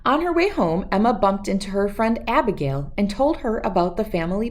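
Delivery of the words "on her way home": ordinary delivery, nothing marked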